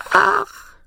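high little granny exclamation; sighing old granny. Acted. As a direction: let it out in a piping old woman voice. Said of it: "antwortete das Mütterchen".

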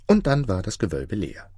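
usual speaking voice

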